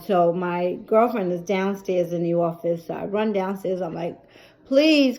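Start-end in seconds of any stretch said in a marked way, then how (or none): 4.7-5.2 high, loud desperate please; pleading cry. Retold or imitated. Daughter begging girlfriend to help lift mom, pleading. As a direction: beg for help.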